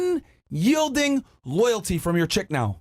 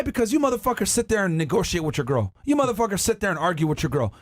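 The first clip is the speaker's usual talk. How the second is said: ordinary talk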